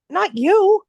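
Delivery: sharp, high refusal. Retold or imitated. Rider refusing the driver who came back, high and loud.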